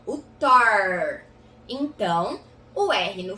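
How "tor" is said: "tor" louder, with long growled R; drawn-out final R. Shown as a demonstration. Teacher showing the final R, drawn-out R. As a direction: hold the R at the end of "tor". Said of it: "outro R no final".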